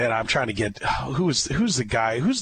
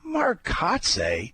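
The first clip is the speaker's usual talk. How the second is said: higher, slow, laughing disbelief; incredulous disbelief; 6 semitones higher, much slower than usual. Retold or imitated. Host retelling his shocked reply to the scout, laughing. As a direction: stretch out the name, laughing in disbelief. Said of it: "And I'm like".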